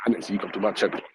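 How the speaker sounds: ordinary talk, nothing marked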